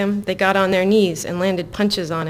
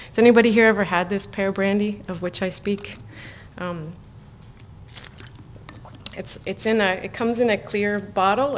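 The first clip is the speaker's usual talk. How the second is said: whispering. Real, not acted. Speaking to reading audience.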